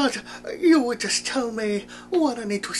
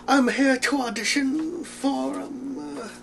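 usual speaking voice

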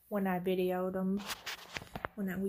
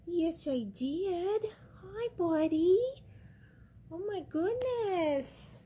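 high, slow cooing pet voice; 8 semitones higher, 4 dB louder, slower than usual. Real, not acted. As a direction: coo sweetly to the cat. Cooing to cat.

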